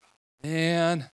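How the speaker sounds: playful put-upon complaint; reluctant groan. Retold or imitated. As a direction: sigh it like a kid told to do chores. Groaning at God's order.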